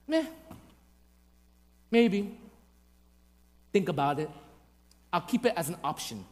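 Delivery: quiet, low, indifferent shrug; acted lukewarm reluctance; noncommittal. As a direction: brush it off half-heartedly. Acts out our lukewarm response to God.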